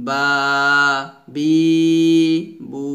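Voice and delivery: বা, বি, বু each with a stretched vowel; separate, lengthened বা, বি, বু; madd demo. Shown as a demonstration. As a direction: hold each vowel evenly in বা, বি, বু. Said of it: "এভাবে আমরা মুখের খালি জায়গা থেকে".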